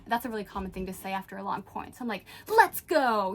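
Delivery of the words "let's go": louder retold victory cheer; getting into it. Player celebrating a point for the camera, getting into it. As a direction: cheer it out with gusto. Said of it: "I'm really getting into it".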